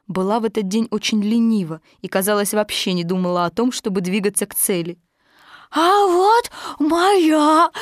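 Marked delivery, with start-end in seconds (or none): 5.7-7.7 high, slow excited little joey shout; a tiny kangaroo calling out eagerly; small child voice, high and loud; Roo crying out. A narrator's reading. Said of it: "крикнул Крошка Ру".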